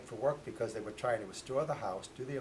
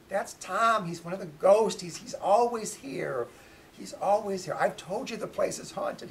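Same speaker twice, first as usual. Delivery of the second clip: high, loud, exasperated I-told-you-so; emphatic insistence; 9 semitones higher, 11 dB louder than usual. Retold or imitated. Daughter tells doubting dad about the ghost, loudly insistent. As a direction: insist loudly, pitched well up.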